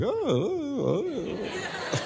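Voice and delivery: high, soft falsetto "oh"s; hammed-up high-pitched ohs; coy mock flattery. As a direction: coo it high in mock bashfulness. Acts his reaction to being recognized.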